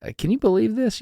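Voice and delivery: high whiny can-you-believe-this; mild mock whine; whiny disbelief. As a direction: fish for sympathy with a whine. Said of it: "the thing where I whine".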